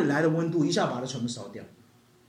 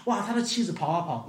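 normal talking voice

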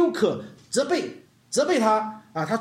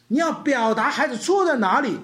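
ordinary talk, nothing marked

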